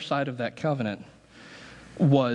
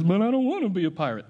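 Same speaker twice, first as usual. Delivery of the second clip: quick, higher whiny protest; a light Seinfeld whine, a bit higher and faster; Seinfeld pirate line; 5 semitones higher, faster than usual. Retold or imitated. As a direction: mimic Seinfeld's complaint in the frilly shirt. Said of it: "the episode of Seinfeld where he's dressed in the frilly shirt and says".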